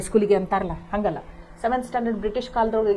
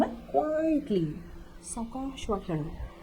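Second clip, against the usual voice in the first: softer, slowed "quietly"; said quietly; 2 semitones higher, 9 dB quieter, slower than usual. Shown as a demonstration. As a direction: show the word quietly. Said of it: "opposite".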